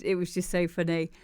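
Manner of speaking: usual speaking voice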